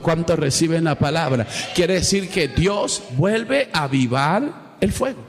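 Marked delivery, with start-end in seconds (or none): none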